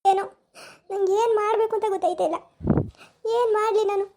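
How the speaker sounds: a mother breaking down in tears; mother crying to her son-in-law and daughter, asking what to do, tearful. Acted. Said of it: "ಅಳ್ಬೇಡ, ಸುಮ್ನಿರು".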